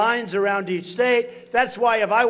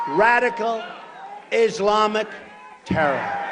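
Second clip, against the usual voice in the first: each word punched with a pause between; word by word slogan. Real, not acted. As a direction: hammer out Radical, Islamic, terror one at a time. Rally catchphrase, word by word.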